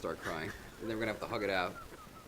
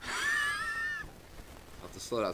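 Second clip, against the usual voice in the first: wheezy breathless; much slower than usual. Retold or imitated. Retold asthma during sex.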